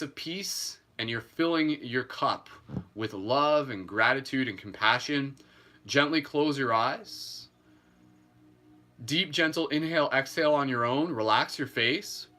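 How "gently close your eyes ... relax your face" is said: guided meditation. Acted. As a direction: talk them gently through each breath. Leads a breathing meditation.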